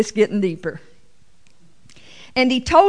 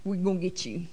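quiet threat; 4 semitones lower, 14 dB quieter, faster than usual. Pretend. Brothers threatening Joseph.

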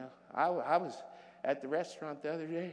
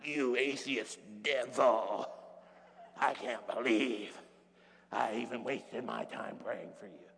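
a hint of a lower, slow self-righteous scold; pompous religious self; 6 semitones lower than usual. Preacher mocking his old pompous self, mild scold. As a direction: sneer down at him lightly. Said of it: "my pompous old religious self".

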